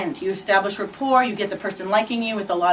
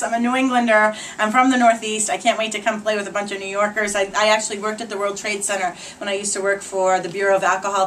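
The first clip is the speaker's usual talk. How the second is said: ordinary talk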